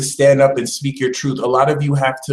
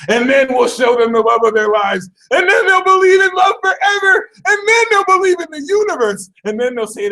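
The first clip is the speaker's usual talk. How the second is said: high, loud, manic glee; cackling cosmic prankster, much higher and louder; gleeful scheming universe; 5 dB louder than usual. Acted. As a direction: crow like a villain whose plan works.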